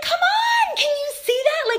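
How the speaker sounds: excited exclaim. Retold or imitated. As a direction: cry out told-you-so. Mock triumph over prayer doubters.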